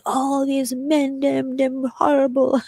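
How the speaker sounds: high, slow Jamaican grandmother voice imitated; a Jamaican granny scolding men, drawn out; imitating an outraged Jamaican grandmother, high and slow; Jamaican grandma. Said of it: "my grandma was Jamaican".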